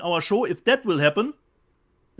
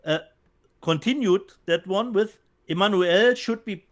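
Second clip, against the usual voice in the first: ordinary talk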